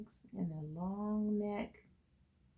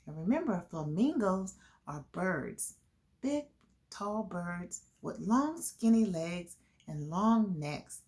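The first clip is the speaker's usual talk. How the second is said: usual speaking voice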